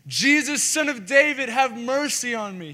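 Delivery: loud, high desperate shout; crying out. Retold or imitated. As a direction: cry out at full voice for mercy. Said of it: "He just cried out".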